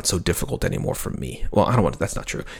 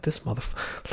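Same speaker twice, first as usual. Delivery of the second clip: breathy under-breath swear, barely voiced; whispered curse; 6 semitones higher, 9 dB quieter, slower than usual. Real, not acted. Dad whispering a curse beside his sleeping son.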